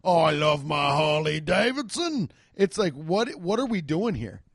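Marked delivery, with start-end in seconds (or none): 0.0-2.3 hammed-up British accent; Jason Statham. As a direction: lay on a broad mock British accent. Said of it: "speaking the Queens".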